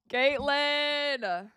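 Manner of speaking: usual speaking voice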